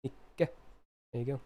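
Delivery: ordinary talk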